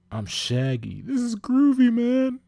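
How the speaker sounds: high, loud cracking cartoon voice; strained, very high goofy voice; Shaggy. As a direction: squeak it like a laid-back cartoon stoner. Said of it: "it's hard to do that voice".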